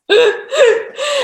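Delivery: high, loud burst of laughter; amused laugh. Real, not acted. Teacher cracking up at her own point, amused.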